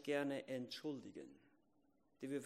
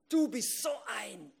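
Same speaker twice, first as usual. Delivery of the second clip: slow, loud, higher mock snarl; angry outburst; 8 semitones higher, 10 dB louder, slower than usual. Imitating someone losing their temper at another, mock angry. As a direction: spit the insult out in a mock rage.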